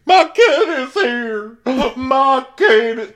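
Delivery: loud, very high, stretched wail; hammed-up frantic screech; mock screaming. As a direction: imitate a hysterical mother screaming. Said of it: "[name] is still screaming".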